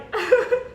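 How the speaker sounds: short high giggle; real sheepish laugh; embarrassed laugh. Real, not acted. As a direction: laugh off the tears. Embarrassed laugh after crying.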